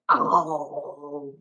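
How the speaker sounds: normal talking voice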